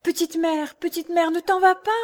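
put-on high child voice calling; crying little boy. A narrator's reading. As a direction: cry out as the boy. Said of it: "continuait à crier". (enfant qui crie, voix aiguë)

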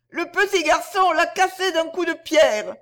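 very high, sustained piercing cry. A narrator's reading. Darzee's wife playing injured before Nagaina, piercing cry. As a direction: cry it out shrilly, high and loud. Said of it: "cria d'une voix perçante".

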